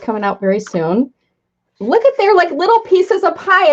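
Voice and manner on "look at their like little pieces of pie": excited. Real, not acted. Noticing the tiny pie pieces.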